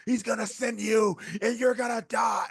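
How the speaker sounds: high, loud, drawn-out imitation of a furious woman; full-voiced mock scream, slow and high; screamed damnation. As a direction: act out her wailing curse, stretching the words. Retold screamed damnation at staff.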